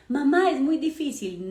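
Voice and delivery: put-on whining child. Child voiced complaining to mother.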